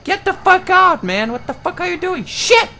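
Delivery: high, loud panicked screaming; breathless fright. Real, not acted. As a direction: scream while running for your life. Chased by a ghost, panicking.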